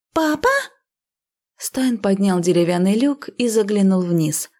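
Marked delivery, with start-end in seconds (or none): 0.1-0.5 put-on very high girlish voice, rising question; surprised daughter. A narrator's reading. Trapped girl notices her father, high surprised call.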